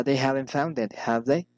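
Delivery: "it" falls, "have they" rises; falling then rising. Shown as a demonstration. Teacher models tag questions, falling then rising on "have they". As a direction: drop on "it", lift "have they". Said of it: "falling or rising intonation".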